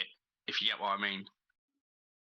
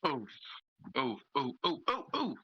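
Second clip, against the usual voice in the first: monkey hooting. Acted. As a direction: hoot like a monkey. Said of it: "That's my monkey impression".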